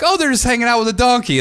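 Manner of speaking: naive passerby. Retold or imitated. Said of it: "you're like".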